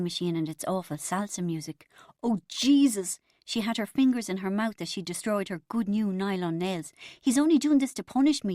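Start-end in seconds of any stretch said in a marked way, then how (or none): 2.2-3.2 exasperated. A narrator's reading. Bridget despairs at answering machine. 7.2-8.5 high, loud lament; anguished complaint. A narrator's reading. Bridget laments that Carlos won't answer, anguished complaint.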